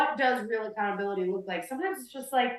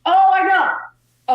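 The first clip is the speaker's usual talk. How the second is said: loud high burst, slowly drawn out; light up as the memory hits; sudden excitement; 10 semitones higher, 9 dB louder, much slower than usual. Real, not acted. Remembering the worst apology ever, loud and excited. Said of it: "sudden recall".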